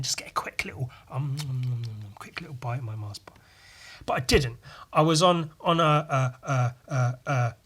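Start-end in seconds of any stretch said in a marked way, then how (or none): 0.0-3.4 whispered, hushed and secretive; sneaky whisper. Retold or imitated. Boy whispering his plan to sneak a bite under the desk.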